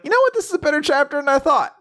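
very high, fast won-over realization; pleasantly surprised. Retold or imitated. Host recalling being won over by the chapter, very high and quick. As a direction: sound suddenly convinced. Host quoting own reaction.

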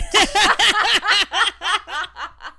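high squealing mock tiger roar; tiger sound. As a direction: squeal out a goofy big-cat noise. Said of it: "here's my tiger, uh, sound".

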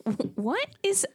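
high, slow, baffled stammer. Retold or imitated. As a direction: stumble over it in confusion. Reacting to the speech.

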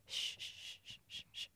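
very soft, rhythmic shushing; imitated soothing shush. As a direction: shush a baby back to sleep. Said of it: "trying to settle him".

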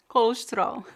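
colesterol clipped to kolʃtɾol; imitating European colesterol. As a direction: squeeze colesterol into two beats. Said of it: "Duas sílabas".

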